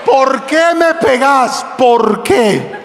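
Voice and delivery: slow, stretched wail of protest; desperate cry. Shown as a demonstration. Pastor showing how the struck thief yelled, desperate cry. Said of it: "Él lo gritó con desesperación".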